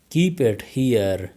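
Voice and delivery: slowed-down teaching model; slow, careful. Shown as a demonstration. Teacher modelling the phrase for learners, slow. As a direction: say it slowly for learners. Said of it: "teacher repeats the phrase twice".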